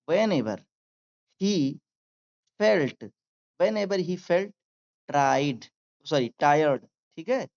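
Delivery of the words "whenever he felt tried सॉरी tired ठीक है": normal talking voice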